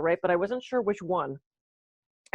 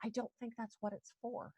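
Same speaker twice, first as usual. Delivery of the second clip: soft, dry put-down, much quieter; dry rebuke; 3 semitones higher, 17 dB quieter than usual. Retold or imitated. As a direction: deflate her gently but flatly. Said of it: "one woman went".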